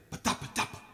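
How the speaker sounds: voiceless pattering snare imitation; snare drum mimic. Shown as a demonstration. Singer mimicking the snare hit that opens the song, voiceless. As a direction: tap out a snare hit with the mouth. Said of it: "is the snare hit".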